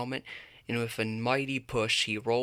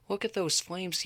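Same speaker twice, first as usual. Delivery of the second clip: much higher mock yell; 8 semitones higher than usual. A narrator's reading. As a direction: point and yell at the flames. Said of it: "yelled Socks".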